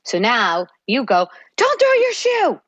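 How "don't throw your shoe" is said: put-on high, sharp scolding snap at the kid; exasperated mom scolding, voice shooting up; angry parent. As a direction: snap at the child like a fed-up mom. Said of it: "you get angry".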